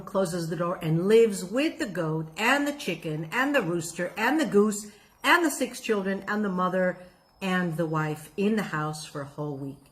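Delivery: ordinary talk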